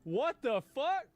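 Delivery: high, slow, stunned exclamation; shocked outburst. Real, not acted. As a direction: drag it out, stunned. Reacting to the clip of her.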